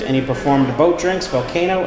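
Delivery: usual speaking voice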